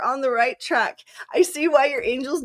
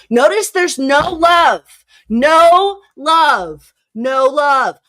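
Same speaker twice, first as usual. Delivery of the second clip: loud, high, slow stressed no love; emphatic no love; 4 semitones higher, 10 dB louder, much slower than usual. Real, not acted. Reader stressing the lack of love to Amy, loud and slow. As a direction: lean hard on no love. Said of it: "I'm just gonna repeat that".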